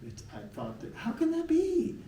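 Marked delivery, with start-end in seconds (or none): 0.9-2.0 disbelief. Real, not acted. Disbelief at the experiment.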